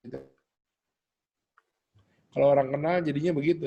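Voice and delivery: usual speaking voice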